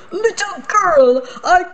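high, theatrical curse of a wounded archduke; Archduke's curse. Acted. Archduke cursing the little girl in a high voice. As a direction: cast the family curse down on her.

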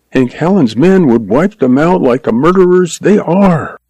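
high, earnest, worried woman's voice; anxious frontier woman. Acted. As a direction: plead it as a worried older sister, pitched high. Voices Lois, distressed, explaining the danger.

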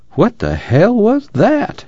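slow, high-pitched disbelief; narrated bewilderment, voice jumping up; baffled demand. A narrator's reading. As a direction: stretch out an incredulous question. Tom baffled after the confrontation.

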